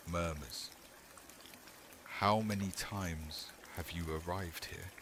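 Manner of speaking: ordinary delivery, nothing marked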